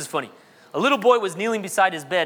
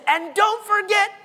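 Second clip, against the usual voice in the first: loud shouted prayer; 12 semitones higher, 4 dB louder than usual. Retold or imitated. Little boy suddenly shouts his bedtime prayer, mock shouting. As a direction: belt it out like a kid demanding a present. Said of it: "shouted really loud".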